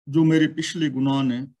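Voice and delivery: usual speaking voice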